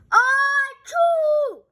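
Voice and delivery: loud mock sneeze. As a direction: blast out a sneeze. Sneezing in the story.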